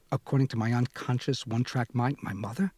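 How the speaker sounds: ordinary delivery, nothing marked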